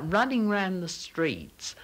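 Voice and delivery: ordinary talk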